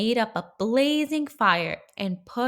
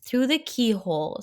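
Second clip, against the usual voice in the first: usual speaking voice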